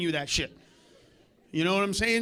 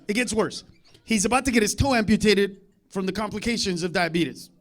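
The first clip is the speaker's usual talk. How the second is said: ordinary talk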